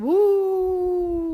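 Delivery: long, loud, high "woo" sliding down; one great sustained gust; howling wind. Acted. North Wind replying to the boy, howling.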